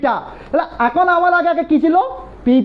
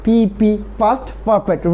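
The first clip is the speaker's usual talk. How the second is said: very slow, lower, drawn out; slow drill; 4 semitones lower, much slower than usual. Shown as a demonstration. Teacher answering his own question slowly. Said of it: "had মানে কোন tense".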